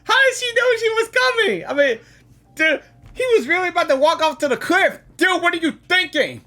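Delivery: relieved excitement. Real, not acted. Relieved outburst after rescue.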